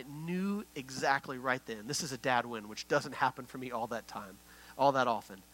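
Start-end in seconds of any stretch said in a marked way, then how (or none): none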